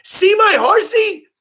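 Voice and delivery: put-on very high, loud, drawn-out kid voice; excited middle schooler. Middle school kid showing the bus driver a toy horse, high mock excitement. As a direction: imitate a kid squealing with excitement.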